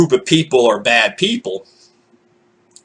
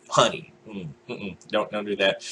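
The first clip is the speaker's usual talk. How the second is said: soft disapproval; 6 semitones lower, 13 dB quieter than usual. Retold or imitated. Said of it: "mock disapproval".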